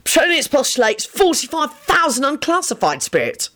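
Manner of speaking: plain reading voice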